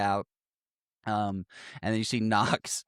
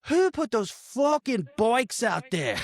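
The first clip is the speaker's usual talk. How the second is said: high, slow, hammed-up entrance line; Knox's bad acting; 11 semitones higher, 3 dB louder, slower than usual. Mocking the actor's entrance in the movie, hammed-up bad acting. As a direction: imitate a terrible actor, drawn out and high. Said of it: "the real worst of his acting".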